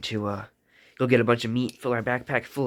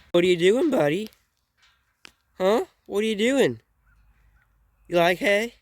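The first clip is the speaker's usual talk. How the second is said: high, loud greeting to a cow; talking to an animal; 8 semitones higher, 6 dB louder than usual. Real, not acted. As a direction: call out to the cow in a raised pitch.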